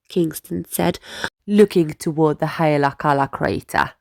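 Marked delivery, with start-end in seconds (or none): none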